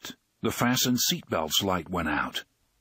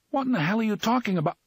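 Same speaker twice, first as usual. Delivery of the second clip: startled; 11 semitones higher, much faster than usual. A narrator's reading. Eddie startled, confused.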